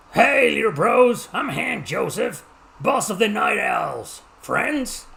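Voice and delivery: high, slow, drawn-out gang boss; boastful gang boss character. Acted. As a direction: play a swaggering outlaw boss, pitched high. Voices game character's lines.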